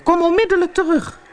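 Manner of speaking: high, fast, frantic order; panicked father calling after his son; urgent call. A narrator's reading. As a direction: rush out a high-pitched command to return. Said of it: "riep hij".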